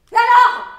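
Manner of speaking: sudden high, explosive yell; very loud, angry challenge; defiant shout. Acted. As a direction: burst out in defiance. Sudden defiant outburst after quiet musing.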